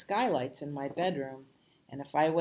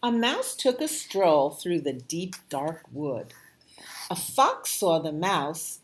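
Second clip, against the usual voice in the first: loud, lively storybook reading; reading to children; 5 dB louder than usual. A narrator's reading. Reading The Gruffalo aloud to a child, animated. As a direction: read it with big voices for the kids.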